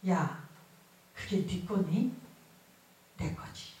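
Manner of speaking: soft, slow, gently chiding God, trailing to a whisper; a lightly voiced God lowering to a whisper. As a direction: speak as God, hushed and patient. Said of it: "주님 뭐라는 줄 아세요".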